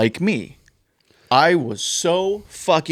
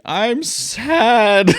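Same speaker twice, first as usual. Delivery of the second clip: high, drawn-out mock whine; mock sad; 10 semitones higher than usual. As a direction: imitate a friend moping, pitched way up. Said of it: "He's like".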